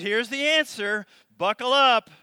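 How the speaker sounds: loud, high, forceful proclamation; booming voice of God. Acted. Preacher booming God's answer to Job.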